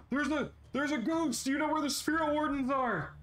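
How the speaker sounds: very high frantic shouting; panicked worker. Acted. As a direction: yell up in terror.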